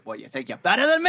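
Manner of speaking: Boston tough-guy challenge; Boston accent, picking a fight; mock Boston attitude. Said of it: "we've been doing Boston accents".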